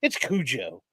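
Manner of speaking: "Cujo" with a plain English J, koo-joe; insisting on J. Real, not acted. Rejecting the writer's version, "Cujo" as koo-joe. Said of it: "The J is silent, I know".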